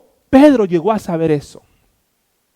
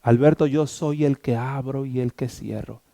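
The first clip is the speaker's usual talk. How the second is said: low, soft voice of God within; lightly voiced divine reply, hushed and lower; inner voice; 5 semitones lower, 7 dB quieter than usual. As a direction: speak softly, like a voice heard inside. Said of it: "en mi interior, ya no fue audible".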